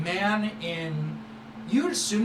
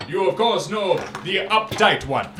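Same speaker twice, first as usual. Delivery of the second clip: louder, sweeping showman voice; grand merchant boast; 4 semitones higher, 6 dB louder than usual. Acted. Merchant Syrah boasting to the young friend, loud and showy. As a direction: boast like a flamboyant trader.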